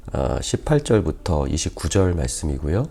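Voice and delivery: ordinary delivery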